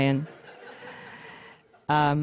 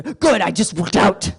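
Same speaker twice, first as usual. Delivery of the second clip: gym bro; 4 dB louder than usual. Retold or imitated. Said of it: "this is what he sounded like".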